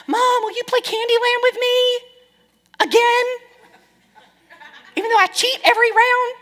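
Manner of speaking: put-on high pleading child asking to play again; whiny kid voice. Mother voicing her child begging to play Candyland, high whiny kid voice.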